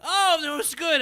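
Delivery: pained. Real, not acted. Burned, eating hot dog.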